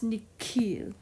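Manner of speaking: "nihil" said slowly as nee-keel; hard K for the H in "nihil". Shown as a demonstration. Teacher warns choir off the H, "nihil" with K. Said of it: "don't try to pronounce that with an H".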